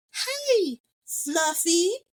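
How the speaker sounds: very high pet-talk voice; cooing at a cat. Retold or imitated. Lucy reading the cat's name tag, high cooing. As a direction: greet a pet in a high, sing-out coo.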